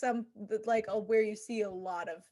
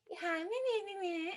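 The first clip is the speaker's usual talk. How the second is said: high, soft kiddie babble; imitating camp kids; 7 semitones higher, 7 dB quieter than usual. Camp kids responding to Miss Hannigan, small high mimicry. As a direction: mimic children in a small raised voice. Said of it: "They were like".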